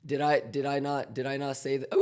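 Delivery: ordinary talk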